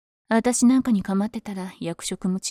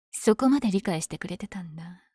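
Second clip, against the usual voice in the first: ordinary talk, nothing marked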